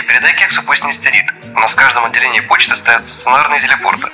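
usual speaking voice

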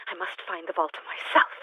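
usual speaking voice

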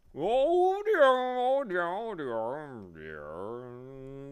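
loud high wail sliding down and fading; flustered bee. A narrator's reading. Bee pacing as time runs out, flustered falling wail. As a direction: fret in drawn-out, falling repeats. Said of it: "continuing to pace nervously".

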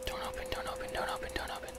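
desperate breathy whisper, pleading. Real, not acted. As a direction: whisper it urgently so nobody hears. Hiding player begs door stays shut.

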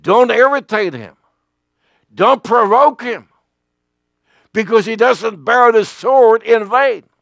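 God thundering a warning to Moses; stern warning. Retold or imitated. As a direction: warn them like God would. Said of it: "He said".

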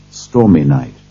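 plain reading voice

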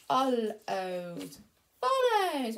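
Followed, follows stretched out slowly; slow careful reading. Shown as a demonstration. Teacher sounding out words for the child, very slow. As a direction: sound out each word slowly. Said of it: "reading green words slowly".

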